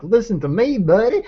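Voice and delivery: high-pitched, hammed-up mock demon voice; demon as some buddy. Shown as a demonstration. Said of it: "didn't wanna come across that the demon's like some".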